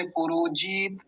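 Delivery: पुरोजित with जित stretched into a long जीत; wrongly lengthened vowel. Shown as a demonstration. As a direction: drag the i of जित in पुरोजित, as the mistake. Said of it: "लंबा नहीं खींचेंगे". (पुरोजित में जित को खींचकर जीत)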